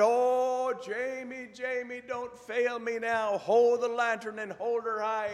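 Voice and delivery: very high, frantic Scottish doctor; Scottish brogue. Acted. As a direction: wail the plea high in a hammed-up Scottish brogue. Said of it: "best Scottish brogue I can come up with".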